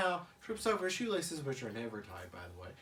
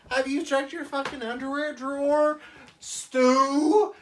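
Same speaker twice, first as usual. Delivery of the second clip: higher, louder exasperated snap; exasperated wife; 9 semitones higher, 11 dB louder than usual. Retold or imitated. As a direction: scold your clueless husband. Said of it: "And she's like".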